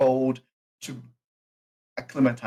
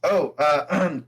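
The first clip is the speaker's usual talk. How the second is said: ordinary talk, nothing marked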